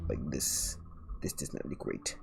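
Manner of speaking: soft, unvoiced mutter to herself. Real, not acted. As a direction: grumble it under your breath. Muttering about her own line art.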